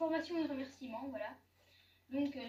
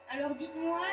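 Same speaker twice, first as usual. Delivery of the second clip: ordinary talk, nothing marked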